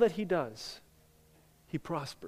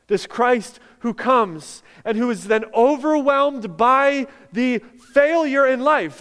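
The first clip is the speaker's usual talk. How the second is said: loud, slowing preaching climax; impassioned preaching; 5 semitones higher, 8 dB louder, slower than usual. Acted. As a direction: build to a slow, weighty proclamation.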